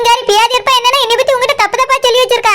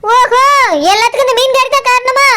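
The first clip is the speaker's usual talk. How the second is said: usual speaking voice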